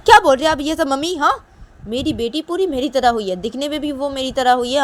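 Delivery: high, loud, offended retort; indignant objection. Acted. Acted indignant objection to mother.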